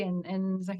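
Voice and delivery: normal talking voice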